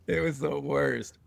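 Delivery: ordinary delivery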